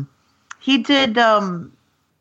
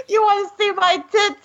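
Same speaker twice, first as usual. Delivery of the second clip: cartoon voice; 10 semitones higher than usual. Quoting drunk wife character.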